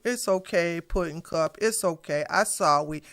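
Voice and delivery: mock the host consoling the crying guest; mock tender comfort. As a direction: coo it like comforting a child, sarcastically. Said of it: "hug her and go".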